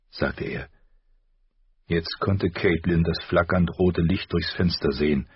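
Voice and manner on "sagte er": ordinary delivery, nothing marked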